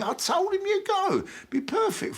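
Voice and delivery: very high, pleading squeal; desperate pleading. Acted. Agent begging client to take the show, desperate pleading. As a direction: plead with pitch shooting right up.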